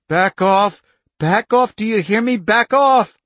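high, loud, desperate repeated yells; frantic screaming. A narrator's reading. Child screaming at her power.

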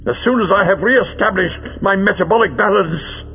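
high-pitched villain calling out with relish; ghoulishly jovial villain, pitched well up; gruesome good humour. A narrator's reading. Villain taunting his sulking henchman in the idol, gruesome good humour. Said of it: "With gruesome good humour, Greel called over".

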